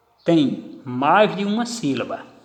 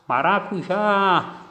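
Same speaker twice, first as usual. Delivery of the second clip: Maracujá slow, JÁ held long and higher; stress on last syllable; 4 semitones higher, much slower than usual. Shown as a demonstration. Teacher showing the tonic syllable, Maracujá with a long raised JÁ. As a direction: draw out the JÁ of Maracujá. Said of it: "Tem a tonicidade na última sílaba".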